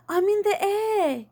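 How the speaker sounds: high, slow, thrilled cry of a flying dad; excited yell. A narrator's reading. Dad yelling to Lizzie that he is flying, high and excited. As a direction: shout it up to the ceiling. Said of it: "he yelled".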